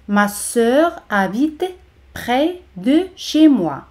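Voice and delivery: "Ma sœur ... habite ... près ... de ... chez moi", slowly; slow teacher's model with pauses in "près de chez moi"; slow, separated words. Shown as a demonstration. Slow model before normal-speed take.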